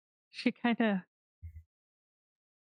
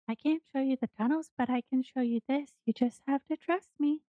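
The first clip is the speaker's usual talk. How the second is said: put-on high-pitched child character; little kid; 7 semitones higher than usual. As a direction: speak high as a little girl. Game master voices little girl.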